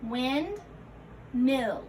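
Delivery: "Windmill" as wind rising, pause, mill falling; split compound. Shown as a demonstration. Teacher modelling compound parts for learners, "Windmill" split by a pause. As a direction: separate the halves of "Windmill".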